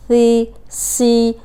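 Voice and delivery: سی held long and very slow; drawn-out si. Shown as a demonstration. Teacher to students, drawn-out سی. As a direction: stretch each سی out. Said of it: "ہم اسے پڑھ رہے ہوتے ہیں سی".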